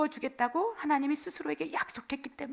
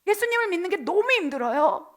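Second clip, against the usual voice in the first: whiny grumbling, pitched up and louder; complaining; 5 semitones higher, 7 dB louder than usual. Acted. Israelites grumbling to God in the wilderness, whiny complaint. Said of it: "불평하고 불만하는 거예요".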